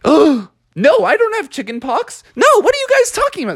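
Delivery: very high, flustered protest; alarmed denial. Acted. Frog denies chicken pox to hotel guests, high alarmed protest.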